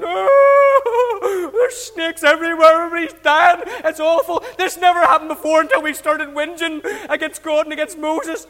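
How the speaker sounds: loud, high mock wail of panic; hammed-up panicked lament; crying out. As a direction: wail like a terrified crowd. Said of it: "started to cry out and go".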